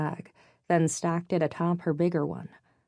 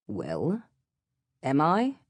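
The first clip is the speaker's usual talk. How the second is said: put-on demanding British accent; slower than usual. A narrator's reading. British passenger demands an answer from two women, stern British accent. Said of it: "he demanded".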